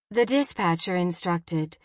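usual speaking voice

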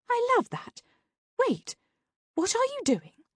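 very high-pitched sidekick, questioning; high, lilting character voice; Sabrina. A narrator's reading. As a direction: pitch it way up for the puzzled partner.

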